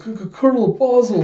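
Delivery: high, loud nervous stutter; imitating a scared underling; startled stammer. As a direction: stammer the greeting in fright. Startled Yorg faces the colonel.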